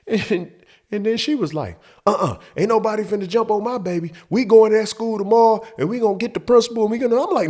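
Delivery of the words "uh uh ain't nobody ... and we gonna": mom up in arms. Retold or imitated. Mom protecting her baby.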